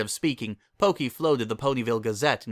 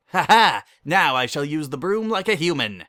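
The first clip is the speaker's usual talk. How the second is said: loud, gleeful and theatrical; hamming it up like a mock villain; gleeful declaration; 5 semitones higher, 6 dB louder than usual. Lyra to herself, grandly announcing her human broom plan, gleeful.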